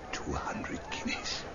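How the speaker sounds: whispered secret sum. Acted. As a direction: breathe it into his ear.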